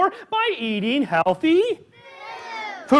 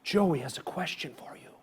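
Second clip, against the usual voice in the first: hushed whisper of mom's line; 7 semitones lower, 10 dB quieter than usual. Retold or imitated. Retelling the mom's quiet words in a whisper. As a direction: whisper it like a secret.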